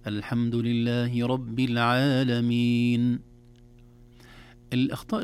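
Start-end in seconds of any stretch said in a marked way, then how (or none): 0.1-3.2 slow, measured recitation of the verse. Shown as a demonstration. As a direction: recite Fatiha's verse slowly. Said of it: "ومن أبرز أمثلته في سورة الفاتحة".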